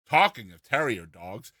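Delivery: ordinary delivery, nothing marked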